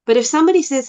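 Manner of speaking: usual speaking voice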